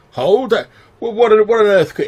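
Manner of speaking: high, loud outraged disbelief; shocked captain. Acted. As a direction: squawk the news back in horror.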